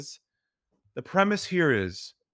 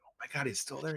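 hushed disbelief, much softer; stunned near-whisper; 13 dB quieter, faster than usual. Retold or imitated. Checking if the caller is still on, hushed. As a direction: breathe it out, barely voiced. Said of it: "I was like".

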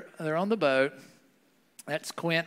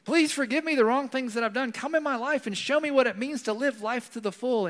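high, heartfelt prayer voice, a little louder; modelled prayer; 9 semitones higher, 6 dB louder than usual. Shown as a demonstration. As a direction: pray it out earnestly, lifting the pitch. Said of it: "Maybe tonight, say".

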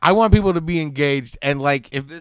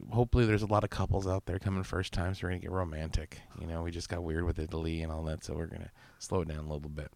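quiet; 7 semitones lower, 12 dB quieter, faster than usual. Acted. Mock romantic pitch, sly irony.

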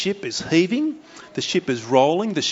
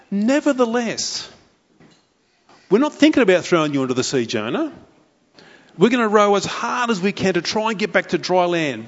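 sailors; 5 semitones higher than usual. Acted. Sailors voiced refusing Jonah.

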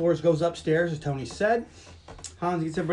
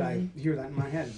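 normal talking voice

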